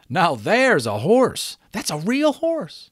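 high, drawn-out admiring cry; exclaiming. A narrator's reading. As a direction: call out in wonder at a passing horse. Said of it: "those who saw her exclaimed".

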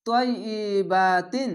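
তয়িবাতিন recited very slowly, vowels stretched; long vowel drawn. Shown as a demonstration. Teacher demonstrating tajweed: slow drawn vowels. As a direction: draw out তয়িবাতিন like Quran recitation. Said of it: "এক আলিফ টেনে পড়বেন".

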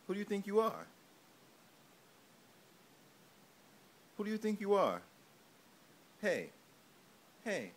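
loud, high mock gangster threat; mock tough guy. Playfully threatening the baby like a mob tough. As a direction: play-act a tough guy menacing the baby.

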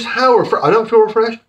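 ordinary talk, nothing marked

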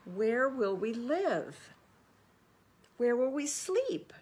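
put-on high, loud, anxious children's voice; worried little ones rising in panic; crying out. Homeless baby possums plead with Papa, high and anxious. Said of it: "cried the young possums".

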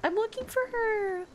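high exasperated protest; indignant cry. Real, not acted. Gamer answering the game's prompt in a high indignant cry. Game tells her to find friend.

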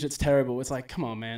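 ordinary talk

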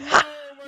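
shrieking victory laugh; high giddy laugh. Real, not acted. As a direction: squeal of laughter.

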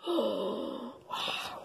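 soft awestruck wow; awed wow. Real, not acted. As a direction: breathe it out in wonder. Reader reacts in awe.

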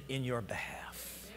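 sudden hushed whisper. Acted. Preacher softening on what Jesus did, whispering. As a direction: drop it to a breath. Sudden drop after loud line.

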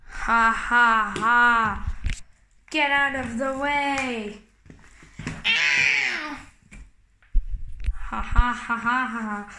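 low villain chuckle; evil monster laugh. Acted. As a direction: laugh like a gloating beast. Giant laughs.

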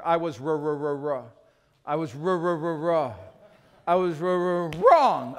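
stuck on the R, then loud release; stammer, unable to get "wrong" out; Fonzie. Retold or imitated. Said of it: "it's like the old Fonzie".

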